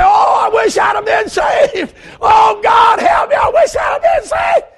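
high, shouted cry of regret; desperate wail. Retold or imitated. Preacher acts out the lost crying out.

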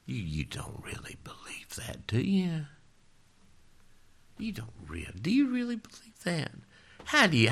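sly whispering doubter, voice rising into taunting questions; tempter's whisper. Acted. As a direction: whisper doubt like the devil in your ear. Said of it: "somebody else gonna whisper in your ear".

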